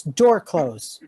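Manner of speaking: ordinary delivery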